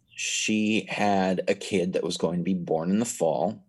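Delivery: ordinary talk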